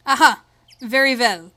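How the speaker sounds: loud mock German, V and W switched; makeshift German accent. Gentleman agreeing to escort the kids, makeshift German accent. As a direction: attempt a German accent by swapping consonants. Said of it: "switch my W's with V's".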